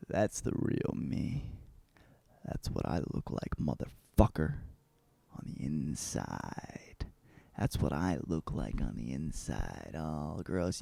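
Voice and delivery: very low, hushed, half-whispered voice; low, soft, hammed-up reveal of a secret self; sinister confession. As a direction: confide it slowly and darkly. [name] reveals his inner self.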